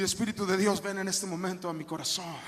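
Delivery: low, softened prayerful plea; quiet prayer. Shown as a demonstration. Pastor modelling what a desperate believer should pray, hushed prayer.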